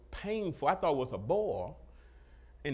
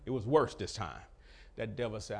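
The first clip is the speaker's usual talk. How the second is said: normal talking voice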